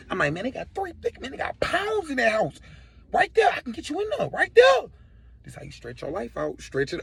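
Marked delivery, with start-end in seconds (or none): 0.3-4.9 high, eager, wheedling offer; squeaky acted persuasion; desperate bargaining. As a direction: talk your way out, pitch leaping up. Desperately offers robbers another target.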